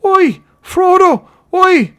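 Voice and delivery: very high, loud drawn-out hail; high, slow carrying call; distant cry. A narrator's reading. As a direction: holler across a great distance. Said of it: "he thought he heard a cry".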